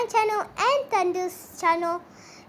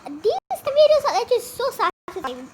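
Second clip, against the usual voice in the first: delighted; 6 semitones higher, 4 dB louder, faster than usual. Real, not acted. Delighted by slime video.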